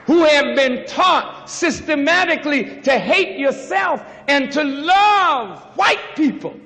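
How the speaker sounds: loud, ringing sermon cry, slow on the names; preaching at full force. Acted. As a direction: hammer it home to the congregation.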